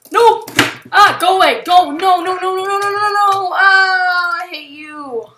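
high, loud panicked yelling; panicked shouting. Real, not acted. Gamer to the chickens attacking him, panicked screaming. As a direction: scream in rising panic as you flee.

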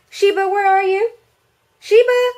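calling out high and loud for a hidden kitty; calling the cat. Real, not acted. Vlogger calling her hiding cat, high and loud. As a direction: call out to find the cat.

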